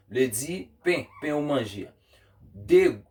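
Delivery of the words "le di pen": ordinary delivery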